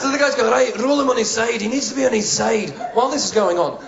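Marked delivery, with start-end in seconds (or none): 0.5-2.7 high, urgent Scottish helper; hammed-up Scottish accent, taking charge. Bystander helping a collapsed man, urgent Scottish accent.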